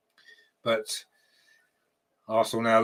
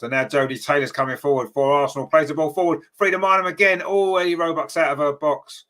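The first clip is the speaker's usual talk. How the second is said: rising, louder live commentary as the attack builds; excited play-by-play; 4 semitones higher, 4 dB louder than usual. Real, not acted. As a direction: call the attack as it happens, pitch climbing.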